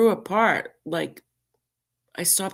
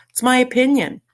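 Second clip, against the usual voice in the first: louder opinion; 7 semitones higher, 6 dB louder, faster than usual. Real, not acted. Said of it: "repeat, first louder".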